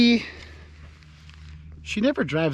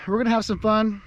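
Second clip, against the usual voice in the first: upbeat and a bit higher; excited; 7 semitones higher, 4 dB louder than usual. Real, not acted. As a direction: pump it up for viewers. Higher, louder take.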